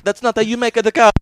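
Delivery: loud, high mock Italian scolding; hammed-up Italian accent. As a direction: play the fussy Italian cook. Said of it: "Italian accent".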